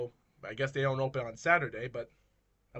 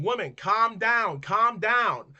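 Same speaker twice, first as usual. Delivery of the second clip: raised, higher voice with drawn-out words; insistent calming; 7 semitones higher, 6 dB louder, much slower than usual. Retold or imitated. Helper to the screaming old woman, insistent loud calming. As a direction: loudly urge her to settle, each phrase falling.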